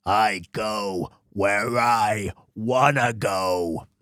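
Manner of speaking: very low, drawn-out tough-guy voice; Wolverine. Acted. Player trying a cartoon hero's voice for the samurai, low and slow. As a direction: drag each word out deep and slow. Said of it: "give him the Wolverine's voice".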